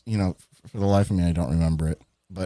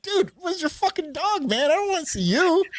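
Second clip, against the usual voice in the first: mock indignation. Storyteller greets new friend wanting his dog, mock-indignant falsetto. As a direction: complain in a hammed-up falsetto.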